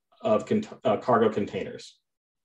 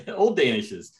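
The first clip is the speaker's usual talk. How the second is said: ordinary talk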